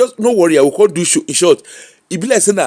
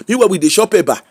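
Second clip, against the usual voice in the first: ordinary delivery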